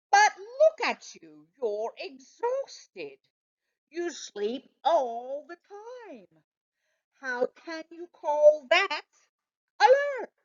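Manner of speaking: high, disbelieving scold, peaking on alert; can't believe he calls that alert; incredulous. Acted. Dog teasing exhausted cat about keeping alert, incredulous.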